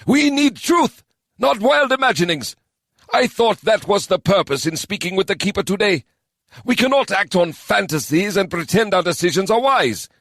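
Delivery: normally calm man losing patience. Acted. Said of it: "his raised voice echoed through the round hall".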